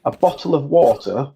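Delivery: crisp southern English accent, every t sounded in bottle and water; a clean TV English bottle of water. Shown as a demonstration. Demoing the accent for a friend, clear southern English. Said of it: "would you like me to say it in a Yorkshire accent".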